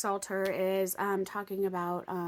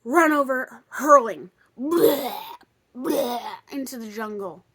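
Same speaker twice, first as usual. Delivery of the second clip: loud mock retch, bleh bleh; retching noises; 9 dB louder, slower than usual. Running into the jungle, loud mock retching. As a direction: gag it out like vomiting.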